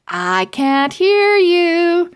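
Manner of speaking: high, loud and slow; hype call. Acted. Bookstore lady egging on the crowd, high and loud.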